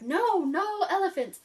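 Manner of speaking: hammed-up panic at the elephant; shrieking refusal, played for laughs; mock freaking out. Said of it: "she's freaking out".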